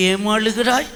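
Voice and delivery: soft, hushed concerned question; tender imitation. Preacher voicing God to weeping Hagar, softly tender.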